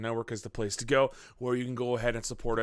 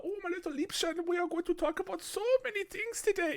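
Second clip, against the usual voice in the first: very high-pitched, creepy German accent; hammed-up German accent in a gross little falsetto; 5 dB quieter than usual. Shown as a demonstration. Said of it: "that high-pitched grossness".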